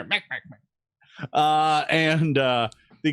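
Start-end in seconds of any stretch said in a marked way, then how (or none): 0.0-1.3 slow, soft creature chatter; a little kobold's timid cries. Acted. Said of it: "And you hear".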